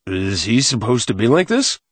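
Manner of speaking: muffled voice behind a surgical mask; muffled through a mask. A narrator's reading. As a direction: speak as if through a breathing mask. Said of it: "its voice muffled through the mask".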